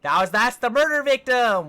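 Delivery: loud, high-pitched cry of disbelief; shocked outburst. Acted. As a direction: shout the shocking reveal. Acted shock at the victim's reveal.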